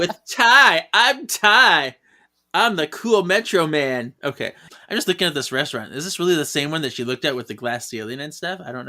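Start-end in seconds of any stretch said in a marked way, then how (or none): 0.0-4.1 loud, raised TV-host introduction. Acted. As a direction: announce yourself like a hyped makeover host. Mimics TV host Ty introducing himself.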